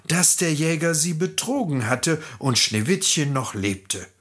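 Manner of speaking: ordinary delivery, nothing marked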